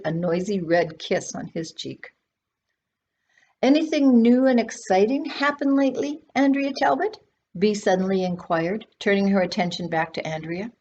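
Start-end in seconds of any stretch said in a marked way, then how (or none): 3.6-7.2 high, eager question; eager old aunt. A narrator's reading. Old aunt eagerly questioning Andrea, high and bright. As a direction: ask brightly, searching her face.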